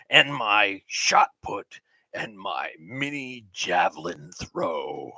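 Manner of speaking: slow, drawn-out host announcement; game show host voice. Acted. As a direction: present the results like a TV host. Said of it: "game show host voice".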